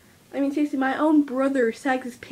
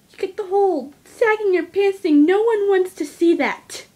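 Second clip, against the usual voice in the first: louder and higher, fed-up complaining; exasperated rant; 6 semitones higher, 6 dB louder than usual. Real, not acted. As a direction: vent about the sagging pants, loud and high. Ranting about sagging pants.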